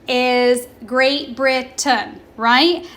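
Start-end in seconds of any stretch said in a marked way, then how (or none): 0.8-2.2 GREAT BRITAIN slowly, split as Brit-tun; careful pronunciation. Shown as a demonstration. Teacher models the correct pronunciation of Great Britain, slow and careful. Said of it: "The correct pronunciation".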